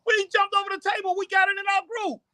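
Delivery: very high squeaky imitation; squeaky falsetto teasing; high mock voice. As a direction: pitch way up, playful mockery. Mocking Nikita voice, high pitched.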